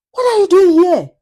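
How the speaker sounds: high, amused, mocking maid's voice. Maid mocking the couple who burst into her room, high and amused. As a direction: sneer it down at the intruders. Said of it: "her voice calm, almost amused".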